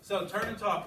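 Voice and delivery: rally the class to start talking; calling out to class. Real, not acted. Teacher tells the students to turn and talk, calling out.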